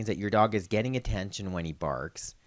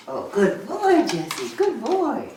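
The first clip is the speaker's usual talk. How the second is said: excited dog praise; 5 dB louder, slower than usual. Real, not acted. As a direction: gasp then very high, slow gushing praise.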